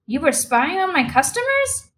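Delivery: high-pitched outraged question; almost hysterical. A narrator's reading. Manager accusing his employee of spying, near hysterical. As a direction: let the voice climb to a disbelieving peak. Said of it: "voice rose to its highest pitch".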